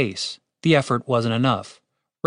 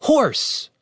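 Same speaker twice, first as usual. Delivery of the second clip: high, sharp call; magic command, pitched up; command word; 13 semitones higher than usual. A narrator's reading. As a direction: call it out like a spell. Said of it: "repeated his command word".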